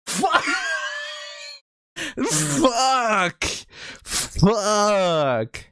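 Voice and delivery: high, drawn-out shriek with laughter; real screamed swearing. Real, not acted. Shouted reaction to accent test result.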